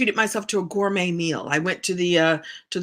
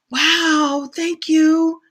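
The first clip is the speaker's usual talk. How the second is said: higher, acted wow of gratitude; touched surprise; 7 semitones higher, 3 dB louder, much slower than usual. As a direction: act out being pleasantly taken aback. Acting own reply to messages.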